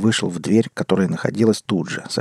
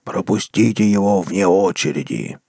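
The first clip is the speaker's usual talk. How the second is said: slow, otherworldly demon voice; slow demon command from hell's gate; inhuman guttural bass. A narrator's reading. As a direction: drag the words out like a monster from below. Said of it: "утробным, нечеловеческим голосом пробасил".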